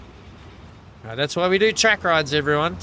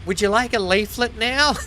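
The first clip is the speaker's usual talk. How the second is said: mocking pushy lady; 8 semitones higher, 4 dB louder than usual. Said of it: "had the gall to me to say".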